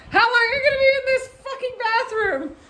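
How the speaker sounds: high, loud frantic shout through a locked door; panicked yell. Retold or imitated. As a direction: yell it trapped and desperate.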